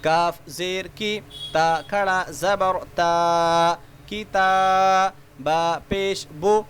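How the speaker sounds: slow, drawn-out chanted letter recitation; sing-song spelling drill. Shown as a demonstration. Teacher spelling letters aloud for children, slow sing-song chant. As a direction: chant each letter and its vowel for young learners.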